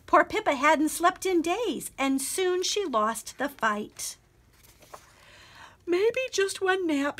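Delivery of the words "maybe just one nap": sleepy yawned line; yawning. Acted. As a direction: say it mid-yawn, drowsy. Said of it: "she yawned".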